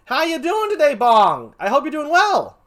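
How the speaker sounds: high, booming well-wishes; loud, high-pitched friendly call; excited greeting. Real, not acted. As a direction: holler cheerfully to a viewer. Calling out to the same viewer.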